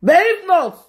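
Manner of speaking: high, sharp outburst; sudden outburst. Real, not acted. Host flaring up at her rival, sudden outburst. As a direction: burst out in protest. Heated argument.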